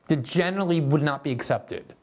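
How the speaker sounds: ordinary delivery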